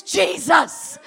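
loud, high, shouted name twice; repeated shouted plea; exhausted cry of Jesus. Shown as a demonstration. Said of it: "all I can say is".

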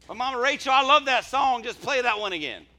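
high, loud imitation of an eager churchgoer; hammed-up shouted request; mock congregant's plea. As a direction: belt it out like a pleading fan. Mimicking a congregant's song request.

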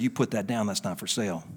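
low, quiet sinister devil; a hushed, menacing devil warning. Pretend. Devil voiced in a story.

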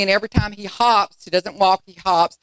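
usual speaking voice

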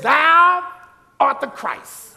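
proclaimed loud on "Thou"; a hint of Peter proclaiming; Peter's confession. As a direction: declare it like Peter in awe. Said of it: "He says".

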